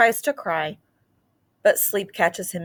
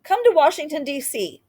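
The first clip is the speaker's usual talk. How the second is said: calling out; 8 semitones higher than usual. Retold or imitated. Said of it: "they said".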